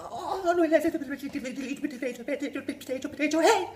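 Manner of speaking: high loud mangled mock Irish; boy's garbled attempt. Boy faking the Irish phrase, loud mangled. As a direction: blurt nonsense with gusto. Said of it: "I had to give it a crack".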